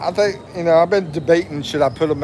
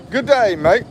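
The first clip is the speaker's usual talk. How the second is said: mock Aussie greeting; 5 semitones higher than usual. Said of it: "I think that's how you say it over in Australia".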